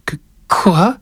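put-on high, stammering child voice; slightly quavering. A narrator's reading. Boy balks at his grandfather's errand, faintly quavering. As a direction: stammer it like an alarmed little boy. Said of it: "la voix un peu vibrante".

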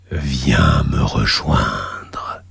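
deep, slow, whispered demon lure; cavernous whisper. Acted. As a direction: whisper it low from the abyss. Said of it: "une voix caverneuse".

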